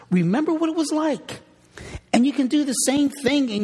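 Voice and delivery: high, ringing call to remember; fervent exhortation. Real, not acted. Preacher urging the congregation to remember. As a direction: lift it up and plead.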